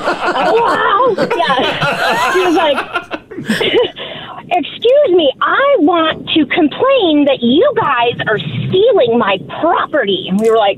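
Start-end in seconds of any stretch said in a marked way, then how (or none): 0.0-1.1 put-on high quavering old lady hello; lightly voiced old woman. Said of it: "you do the best old lady voice". 4.5-10.2 put-on old lady voice. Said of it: "really old lady".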